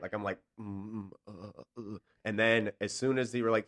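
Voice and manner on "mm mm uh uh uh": small muffled groans, imitating holding back vomit; acted queasy groans. Actor standing there trying not to throw up, softly groaning.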